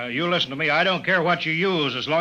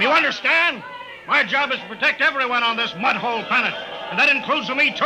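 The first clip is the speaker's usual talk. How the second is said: angry shout; 7 semitones higher than usual. Acted. Colville rounding on Tom for interrupting, shouting angrily.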